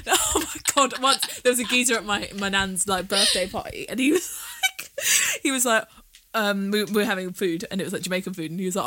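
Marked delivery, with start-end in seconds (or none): 3.9-4.9 laughing through the words; bursting into laughter. Real, not acted. Laughing while starting the story.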